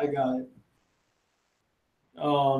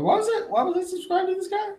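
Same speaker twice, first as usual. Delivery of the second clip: high surprised disbelief; 13 semitones higher, 4 dB quieter, faster than usual. Real, not acted. Shocked he was subscribed.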